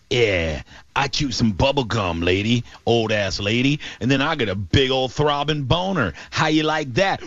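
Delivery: low, slow mock seducer; sexy-talk impression. Host mimicking the dog owner flirting with the caller, mock sexy. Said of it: "did he say it all sexy like?".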